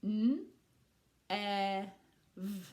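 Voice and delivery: N, E and V as separate drawn-out letter sounds; sounding out. Shown as a demonstration. Teacher modelling three letter sounds for children, sounding out. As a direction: sound out N, E, V one at a time. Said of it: "sound out these three letters".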